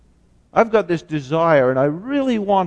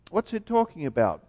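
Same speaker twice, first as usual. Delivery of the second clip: ordinary delivery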